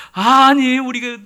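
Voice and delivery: high, loud mock protest; resentful complaint. Israelites in the desert blaming Moses, resentful. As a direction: burst out complaining, drawing out the first word. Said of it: "원망하는 거예요".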